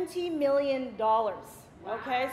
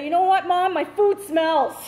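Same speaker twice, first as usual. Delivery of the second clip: high, insistent acted whine to mom; child's complaint; 5 semitones higher, 4 dB louder, slower than usual. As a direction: complain to your mother, dragging out the last words.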